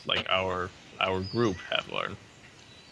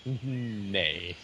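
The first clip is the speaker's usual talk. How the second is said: drawn-out imitated neigh; word stretched into a falling neigh; horse's neigh. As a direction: say it as a long horse whinny. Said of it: "And Diablo says".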